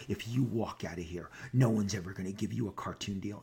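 a low, quiet, menacing executive threat; imitating the executive. Executive blocking the door, low quiet threat. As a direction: drop the voice low and soft, like a warning. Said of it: "She goes".

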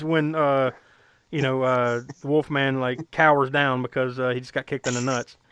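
ordinary delivery

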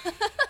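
sudden high laugh; high squeal of laughter. Real, not acted. Laughs at joke about friends.

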